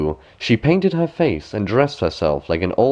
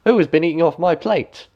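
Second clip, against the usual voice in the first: second dwarf; 8 semitones higher than usual. A narrator's reading. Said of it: "The second".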